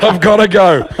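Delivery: high, urgent sign-off; flustered hang-up. Retold or imitated. Comedian ending the snatched-phone call, high flustered voice. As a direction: act desperate to get off the phone. Acted hang-up in retold joke.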